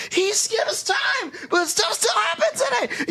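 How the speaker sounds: screaming high in disbelief, slowed; frantic high shouting. Retold or imitated. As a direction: yell it like a baffled meme rant. Quoting the classic meme rant.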